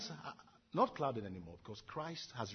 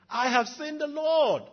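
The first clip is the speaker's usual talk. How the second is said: high, loud, slow, overjoyed; excited cry; 14 semitones higher, 13 dB louder, slower than usual. Acted. Preacher replaying Mary's news to the disciples, ecstatic. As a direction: shout it out with joy. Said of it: "She was excited".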